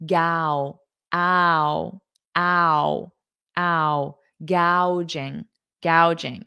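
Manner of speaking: "Gao, ow, ow, ow" drawn out, then "gouging" said whole; pronunciation drill. Shown as a demonstration. Teacher drilling viewers on saying "gouging", slow and clear. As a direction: break "gouging" into its "ow" sound, then join it back. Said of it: "notice the pronunciation".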